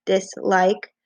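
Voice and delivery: "Dislike" with a gap between dis and like; divided syllables. Shown as a demonstration. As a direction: show where "Dislike" divides. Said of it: "hear how those syllables divide".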